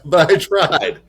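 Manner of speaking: chuckling through the words; laughing. Real, not acted. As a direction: laugh it off sheepishly. Laughing at failed Afrikaans.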